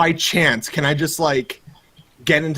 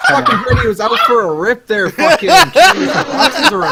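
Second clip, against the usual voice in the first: put-on Canadian hoser accent, higher and louder, breaking into laughter; Canadian accent, laughing; 9 semitones higher, 7 dB louder than usual. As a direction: crack up mid-line in a thick Canadian accent. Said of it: "he said again in your accent".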